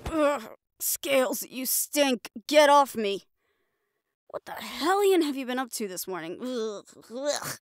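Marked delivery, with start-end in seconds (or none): none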